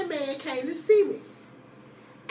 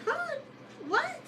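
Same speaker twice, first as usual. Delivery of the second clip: very high, small questioning yelps; shocked disbelief; 12 semitones higher, 7 dB quieter than usual. Retold or imitated. Hearing girl messed with her man, high-pitched disbelief. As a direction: reenact a stunned squeak of surprise.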